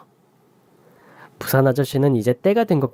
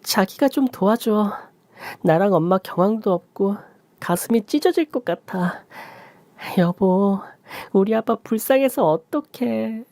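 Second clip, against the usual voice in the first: much higher, slow, put-on wailing; fake sobbing; 9 semitones higher than usual. Wife calling her husband with faked grief, slow and wailing. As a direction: fake grief to fool him. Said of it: "전 연기를 이어갔습니다".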